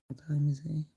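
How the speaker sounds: hushed whisper to herself; whispered aside. Real, not acted. Streamer whispers to herself, checking the time.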